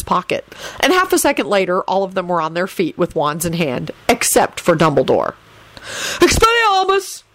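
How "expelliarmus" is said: high bellowed incantation; bellowed spell. A narrator's reading. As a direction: cast the spell with a ringing, high-pitched cry. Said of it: "Albus bellowed".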